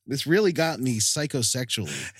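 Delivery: normal talking voice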